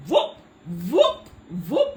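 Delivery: rising party whoops; excited whoops. Real, not acted. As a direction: whoop it up like you're hyping a crowd. Excited hype calls on stream.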